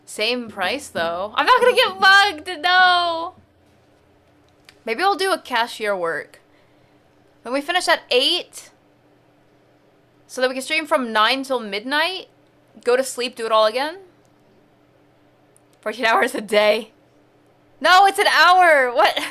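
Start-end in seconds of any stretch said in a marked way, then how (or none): 1.4-3.4 higher and louder protest; loud indignant no. Real, not acted. Reacting to risky job. 17.8-19.2 louder, higher outburst; loud correction; loud protest. Real, not acted. Reacting to game schedule.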